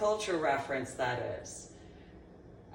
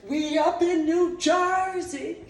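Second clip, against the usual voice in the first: louder, slow mocking boast; hammed-up hometown brag; mock swagger; 8 semitones higher, 6 dB louder, slower than usual. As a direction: drawl it like a proud Jersey kid. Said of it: "Tony Ann wasn't like".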